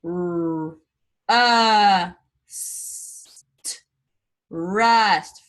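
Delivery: B, r, a, th said apart; segmenting sounds. Shown as a demonstration. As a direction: break broth into its sounds. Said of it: "break these words down into sound".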